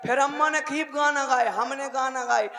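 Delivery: normal talking voice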